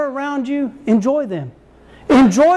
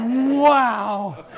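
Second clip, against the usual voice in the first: drawn-out mock awe; awed kid. Kids impressed by Dad's big words, in mock awe. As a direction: marvel like an impressed child.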